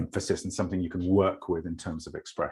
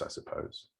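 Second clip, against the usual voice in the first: whispered, trailing off at the end; soft fading close; 13 dB quieter than usual. Acted. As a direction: let the line dissolve into a breath. Rereading the closing line.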